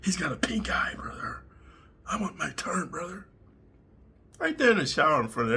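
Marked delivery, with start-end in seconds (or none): 0.0-3.3 softer, half-whispered imitation; hushed mock inmate pleading for a turn. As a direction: lean in and egg him on quietly. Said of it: "the woods be like".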